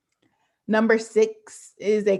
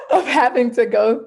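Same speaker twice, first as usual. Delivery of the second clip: words bubbling with laughter, a bit louder; laughing through words; 4 semitones higher, 7 dB louder than usual. Real, not acted. Trying to resume her story while still laughing. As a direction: keep laughing as you talk.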